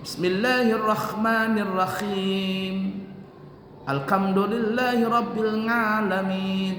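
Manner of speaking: slow, chanted Fatiha recitation; Quran recitation. Shown as a demonstration. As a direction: recite it slowly and melodically. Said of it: "Nah, ini nih".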